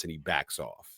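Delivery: ordinary talk, nothing marked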